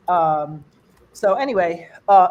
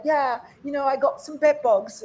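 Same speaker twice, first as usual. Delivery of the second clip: higher imitated British voice; British accent; 5 semitones higher than usual. Comic mimicking how friend says it, British accent. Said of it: "the way she says it".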